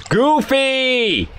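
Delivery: high yelled name; calling out. Real, not acted. Gamer yelling for teammate Goofy, calling out. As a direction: shout his name across the map.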